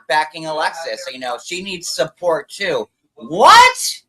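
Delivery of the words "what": surprised. Real, not acted. Surprised outburst at pizza bite.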